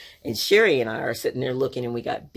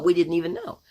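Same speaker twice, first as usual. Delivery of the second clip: put-on higher-pitched kid protest; protesting children; 5 semitones higher, faster than usual. Sisters trying to tell mom, higher protesting voice. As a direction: plead innocence like a child. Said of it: "we're trying to tell Mom".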